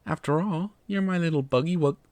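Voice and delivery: put-on high cooing baby talk. Teasing Coxa in a high cooing baby voice. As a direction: coo at him like a baby. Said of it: "Putting on my baby voice".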